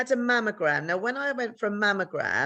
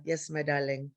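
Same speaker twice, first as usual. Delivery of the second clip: put-on lower, softer Italian-accented reply; 4 semitones lower, 6 dB quieter than usual. Oncologist confirming breast cancer, soft Italian accent. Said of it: "I had to do an Italian accent".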